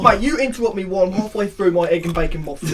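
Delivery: loud, hammed-up Cockney indignation; Cockney accent. Teacher interrupted eating a muffin, loud mock-Cockney outrage. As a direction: play an affronted Cockney, very loud. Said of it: "it's like a Cockney accent".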